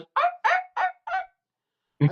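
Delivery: very high, loud animal yips; shrieked little rodent yaps; imitated prairie dog bark. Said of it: "pokes his head out and he says".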